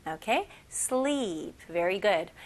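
ordinary delivery

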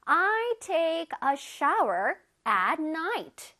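very slow, word-by-word teacher's model; slow modelling. Shown as a demonstration. Teacher reads an example for children, very slowly. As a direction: stretch each word out clearly for learners.

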